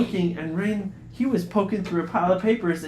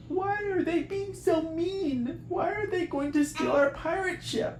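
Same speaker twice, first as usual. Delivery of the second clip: crying; 13 semitones higher than usual. Acted. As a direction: whimper it out, hurt and confused. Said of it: "Sadie started to cry".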